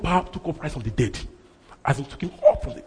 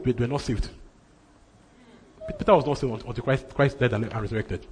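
ordinary talk

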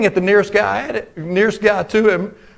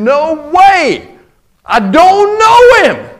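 much higher and louder, a furious slow shout; swearing denial; 8 semitones higher, 6 dB louder, much slower than usual. Retold or imitated. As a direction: fling the denial at her in a panic. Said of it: "started cussing and swearing".

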